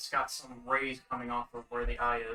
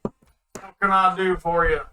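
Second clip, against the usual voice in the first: gruff Goliath shopkeeper; 5 semitones higher, 11 dB louder than usual. Acted. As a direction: boom it out like a giant.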